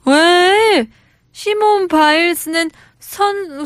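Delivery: hammed-up objection, stretched English ending; Hyunwoo. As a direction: argue back like an indignant dreamer. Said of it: "I'm Hyunwoo".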